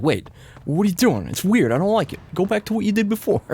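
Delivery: imitating viewers. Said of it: "they'd be like".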